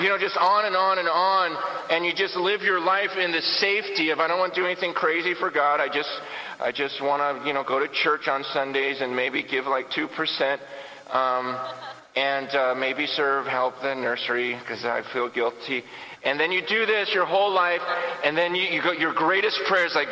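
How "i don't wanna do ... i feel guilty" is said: low, half-hearted church voice; a hint of a bored churchgoer; mock complacent believer.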